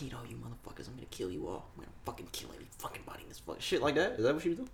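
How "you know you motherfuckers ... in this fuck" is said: mock threat.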